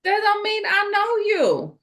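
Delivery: high, sharp brush-off, lightly imitating Wendy; dismissive retort. Imitating Wendy brushing off a woman who claims to know her, high and dismissive. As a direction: shut her down: you don't know me. Voicing Wendy rejecting neca.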